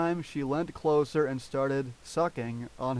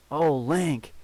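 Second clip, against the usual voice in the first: cry of passion; 2 semitones higher, 6 dB louder than usual. A narrator's reading. Lover cries out in passion.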